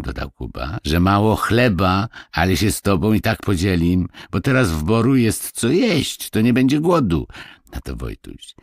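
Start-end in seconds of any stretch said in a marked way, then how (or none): 0.8-7.3 put-on higher, animated boy's voice; reassuring village boy. Kuba promises Marysia to share their bread, eagerly. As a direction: promise it eagerly like a country lad.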